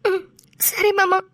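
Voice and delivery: slow, tearful agreement; crying. Acted. Wife agreeing to her husband through tears, crying slowly. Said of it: "please அழுவாத ரோஜாம்மா".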